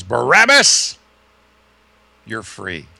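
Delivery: jailer calling out. Retold or imitated. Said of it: "who says".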